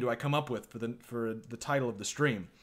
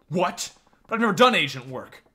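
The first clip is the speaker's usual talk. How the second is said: startled; 5 semitones higher, 8 dB louder than usual. Acted. Surprised character objecting to new job.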